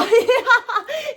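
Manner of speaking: loud, high-pitched real laugh bursting out; high burst of laughter. Real, not acted. Vlogger laughing at her own smorodina joke, high and loud. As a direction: crack up at her own silly rhyme.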